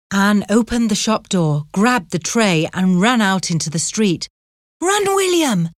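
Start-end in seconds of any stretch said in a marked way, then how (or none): 4.8-5.7 high cry of run; urgent shout. A narrator's reading. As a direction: call out in panic. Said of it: "she shouted".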